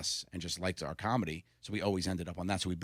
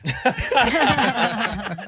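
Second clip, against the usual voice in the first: high, loud burst of real laughter; helpless laughing fit; cracking up out loud; hysterical laugh; 12 dB louder than usual. Real, not acted. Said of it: "Now I'm hysterical".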